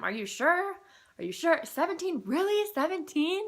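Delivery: can't quite believe the number; high-pitched giddy checking and rechecking; stunned disbelief. Retold or imitated. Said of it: "asking them".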